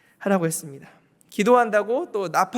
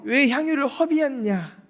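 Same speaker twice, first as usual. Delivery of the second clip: scolding disciples; 7 semitones higher, 4 dB louder than usual. Retold or imitated. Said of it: "혼내며 또 화내며".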